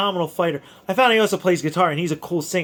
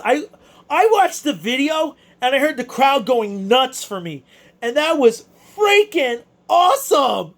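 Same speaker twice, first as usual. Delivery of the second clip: ordinary delivery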